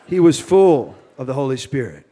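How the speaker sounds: light Cajun accent. Shown as a demonstration. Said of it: "I'm trying to shake my Cajun accent".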